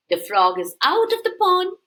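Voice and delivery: usual speaking voice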